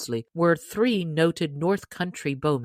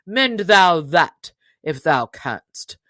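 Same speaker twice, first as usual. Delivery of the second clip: drawn-out loud dare; slow, loud taunting stranger; 7 dB louder, slower than usual. Acted. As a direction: challenge him word by word, slowly.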